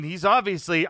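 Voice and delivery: usual speaking voice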